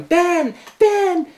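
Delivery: high, loud mock scream; imitated scream. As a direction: shriek the name high, imitating her calling upstairs. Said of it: "I remember her screaming upstairs".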